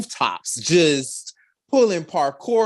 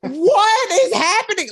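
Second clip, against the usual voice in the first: excited scream; 5 dB louder than usual. Retold or imitated. Screaming at the stunts.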